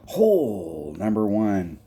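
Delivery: "Hole number one" stretched out slowly, every word drawn long; slow drawn-out announcement. Shown as a demonstration. Calling the first hole to his co-host, slowly drawn out.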